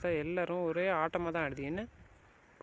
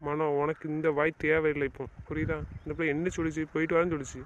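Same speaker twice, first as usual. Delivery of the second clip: usual speaking voice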